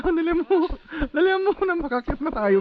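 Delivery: high, eager hurry-up; excited urging. Real, not acted. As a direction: push them on, squeaky and playful. Urging friend uphill, very high. (masiglang pagmamadali)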